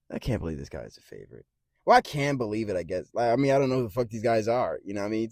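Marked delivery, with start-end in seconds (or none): 0.1-1.4 repeated under the breath, softer and lower; muttered. Real, not acted. Muttering his disbelief at the betting odds, quietly. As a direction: say it again to yourself, disbelieving.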